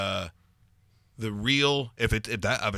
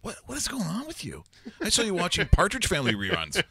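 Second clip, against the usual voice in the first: high-pitched pestering son voice; son nagging; 9 semitones higher, 3 dB louder than usual. Retold or imitated. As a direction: scold like a teenage son. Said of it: "my son's going".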